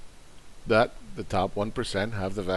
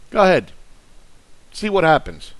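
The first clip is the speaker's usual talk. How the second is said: loud, higher taunting challenge; angry dare; 5 semitones higher, 7 dB louder than usual. Real, not acted. Ranting at MMT fans, daring them to invest, loud taunt.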